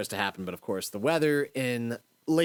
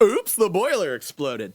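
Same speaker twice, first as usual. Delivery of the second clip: mock innocent; 7 semitones higher, 6 dB louder than usual. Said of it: "We're like".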